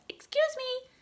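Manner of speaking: very high little excuse me; tiny high squeaky cloud; tiny polite voice. Acted.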